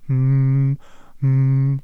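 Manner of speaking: sustained monotone hum; long, droning "mm"; humming. A narrator's reading. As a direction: hum like a voice exercise. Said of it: "summte er immer vor sich hin".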